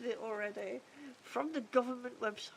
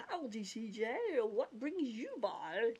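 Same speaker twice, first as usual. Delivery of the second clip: slow, drawn-out cartoon greeting; Goofy; slower than usual. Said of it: "that was my goofy, goofy accent".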